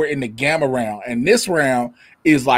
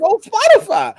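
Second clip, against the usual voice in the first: very high, louder put-on exclamation; mock amazement; 5 dB louder than usual. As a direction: squeal it in mock shock. Acted reaction to Spotify's playlist.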